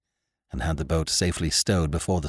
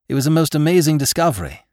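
higher, louder and quick; excited; 8 semitones higher, 5 dB louder, faster than usual. Acted. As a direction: marvel at it, rushing. Doctor marvels at find.

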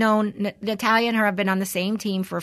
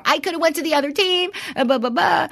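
high, fast mock whine; loud-ish, worked-up imitation of crying protest; Natalia crying; 10 semitones higher, 3 dB louder than usual. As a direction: imitate her tearful complaint, high and rushed. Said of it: "when she was crying to me".

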